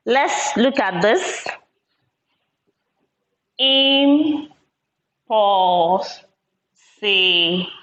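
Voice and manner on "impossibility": "Impossibility" stretched out very slowly; very slow "Impossibility" for counting; slow syllable split. Shown as a demonstration. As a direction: pull "Impossibility" apart, one syllable at a time. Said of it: "Let's count".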